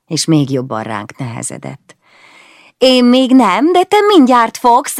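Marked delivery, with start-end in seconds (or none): 2.8-5.0 playful threat. Acted. Teen threatens to tickle brother.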